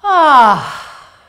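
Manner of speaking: sharp gasp in, then a loud high ah sigh; inhale and sigh. Shown as a demonstration. Teacher demonstrating the breath in and sigh out. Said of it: "That's enough".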